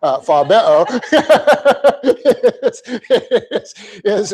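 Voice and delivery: Fabeo corrected with a laugh; amused self-correction. Real, not acted. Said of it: "not Fabio".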